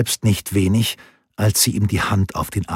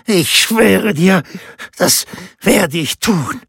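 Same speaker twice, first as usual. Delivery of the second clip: much higher, slow, strained rasping oath; rasping vow; 12 semitones higher, 3 dB louder, slower than usual. A narrator's reading. Rinaldo swearing to Laura, high strained rasp. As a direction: swear it, voice cracking with desire. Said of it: "röchelte er mit vor Verlangen rauer Stimme".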